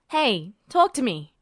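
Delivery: a light edge of impatience; a bit annoyed. A narrator's reading. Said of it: "a bit annoyed".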